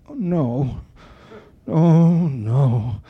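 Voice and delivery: a composer's drawn-out, mock-anguished groan; imitated moaning dismay. Composer head in hands at rehearsal, moaning in dismay. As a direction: groan it out, imitating someone in despair. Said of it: "hold his head in his hands and sit going".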